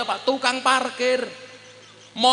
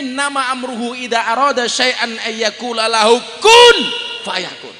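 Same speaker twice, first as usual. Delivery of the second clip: louder chanted recitation; loud chanted verse; Quran recitation; 6 dB louder than usual. Real, not acted. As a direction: intone the verse with force.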